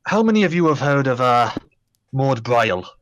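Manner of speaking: a hint of Scottish dwarf; a lightly voiced Scottish dwarf; Scottish accent. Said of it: "my terrible Scottish accent".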